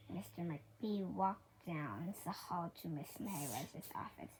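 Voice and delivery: plain reading voice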